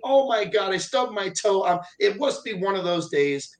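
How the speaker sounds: high-pitched, hammed-up woe; mock self-pity. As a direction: exaggerate a whiny poor-me lament. Mocking people who pity themselves.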